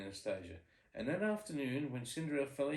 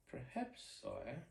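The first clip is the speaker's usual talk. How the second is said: timid murmur; 2 semitones higher, 7 dB quieter, much slower than usual. Acted. Duke's timid doubtful reply.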